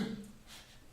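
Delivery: a sudden sharp unvoiced gasp; surprised gasp. Shown as a demonstration. Teacher demonstrating forte piano's surprise with a gasp. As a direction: gasp as if the floor just dropped out. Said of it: "that kind of surprise".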